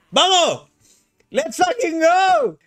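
high, drawn-out rallying shout; hyped cheer. Real, not acted. Streamer launching the Pudú hashtag challenge, hyped cheer. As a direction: cheer the crowd into action.

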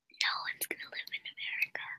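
very soft, quick whisper. Real, not acted. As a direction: whisper it quickly, barely voiced. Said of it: "What am I whispering?".

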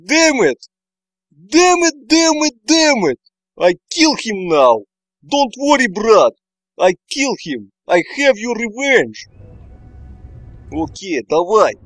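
loud furious cursing, vowing revenge; pissed off. Real, not acted. Avenging his fallen character, loud angry ranting. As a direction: rage at the game, spitting out threats. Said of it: "I got pissed a little bit".

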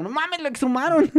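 high mock yell of shock; retold shout. Brother reacting to the Reaper, high mock yell. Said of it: "lo primero que gritó".

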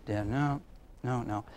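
low, soft, flat refusal; a dad who won't even look up; stern dad refusing. Retold or imitated. Dad brushing off his son, low and flat.

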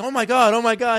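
high, loud mock horror; horrified onlookers. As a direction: exclaim like appalled parents. Other parents' shock, acted out.

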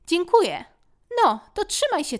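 put-on high, brushing-off child voice; embarrassed young son; proud boy. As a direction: insist you're too big for hugs. Boy saying goodbye.

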